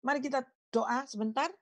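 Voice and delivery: ordinary talk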